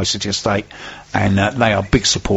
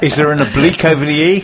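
slipping into a South African accent; higher, slightly South African twang; 4 semitones higher, 3 dB louder, faster than usual. Real, not acted. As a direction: ask it with a hint of South African. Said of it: "Why have you gone South African?".

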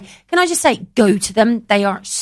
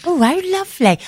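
delighted; 7 semitones higher than usual. Shown as a demonstration. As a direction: savour it sweetly. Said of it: "thinking about how to intonate it".

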